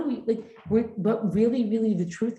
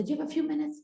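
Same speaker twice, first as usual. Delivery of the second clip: eager invitation; 6 semitones higher than usual. Retold or imitated. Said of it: "she would say".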